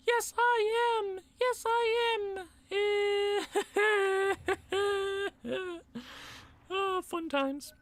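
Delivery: high, slow mock sob; fake-cry about playoff nerves; hammed-up whimper of stress; mock wail. Stressed about the postseason, mock wailing.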